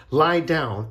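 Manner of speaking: ordinary delivery, nothing marked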